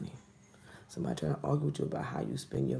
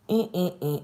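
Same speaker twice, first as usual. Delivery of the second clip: loud, higher mm-mm of disapproval; disapproving hum; 4 semitones higher, 7 dB louder than usual. Real, not acted. Reader to client, a loud humming no. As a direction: shake the head with a humming no.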